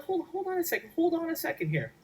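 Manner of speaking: high, half-offended protest; host mock-protesting to his cousin, indignant. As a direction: play at being wounded about the house. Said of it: "and I'm like".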